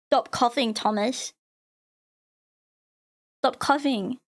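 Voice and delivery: usual speaking voice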